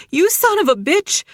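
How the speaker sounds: high, loud, angry cry; furious outburst. A narrator's reading. Jilly hitting Dylan after he vanished, furious shout. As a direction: spit it at him as you hit him. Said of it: "and punched Dylan in the chest".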